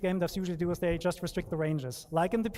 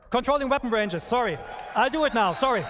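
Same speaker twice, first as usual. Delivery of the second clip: flustered, louder nervous apologies; rattled presenter losing his place; 4 semitones higher, 5 dB louder than usual. Real, not acted. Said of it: "I'm sorry, I'm nervous".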